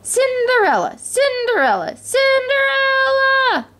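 high singsong summons, last one stretched; insistent calling. A narrator's reading. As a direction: keep calling her, drawing out the name. Repeated summons from the household.

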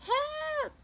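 very high cry for help; shouted. A narrator's reading. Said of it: "Francine shouted".

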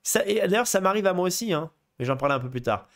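ordinary talk, nothing marked

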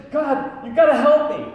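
loud, high pleading cry; desperate plea. Acted. Man on the cliff begging God, loud and high. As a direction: beg God at full volume.

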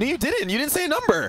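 insist, voice up high; indignant. Real, not acted. Mock argument continues.

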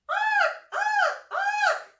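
high, loud bird screech; screeching raven. Acted. Baby raven screeching on the drive home, high squawks. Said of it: "Mac screeched in the car".

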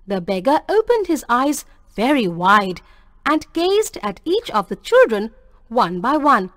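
usual speaking voice

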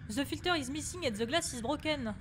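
reading English with a French accent; strong French accent in English. Real, not acted. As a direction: read it out in clumsy French-flavoured English. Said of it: "J'en peux plus de cet anglais".

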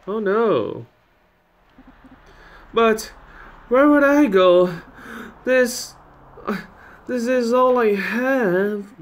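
put-on high, distraught old-lady voice; old woman losing her home; distressed old lady. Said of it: "it's an old lady".

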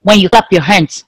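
ordinary delivery

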